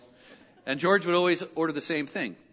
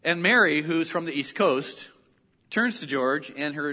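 ordinary talk

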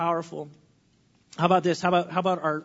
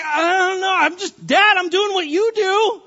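put-on higher kid voice, slowed down; little boy; 10 semitones higher, 7 dB louder, slower than usual. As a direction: play a confused little boy. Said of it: "he's like".

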